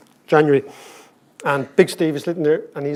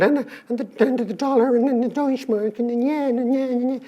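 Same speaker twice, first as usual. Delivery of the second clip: voice up high; 8 semitones higher than usual. Retold or imitated. Terrified young economist hearing himself over the tannoy, high gabbling voice. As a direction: imitate your own voice stuck up high over a tannoy. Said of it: "your prices up here".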